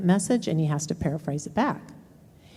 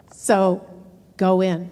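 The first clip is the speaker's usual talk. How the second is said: normal talking voice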